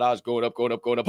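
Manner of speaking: normal talking voice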